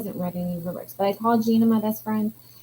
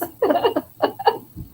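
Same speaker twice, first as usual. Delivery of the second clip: breathy laugh; 7 semitones higher, 3 dB louder than usual. Real, not acted.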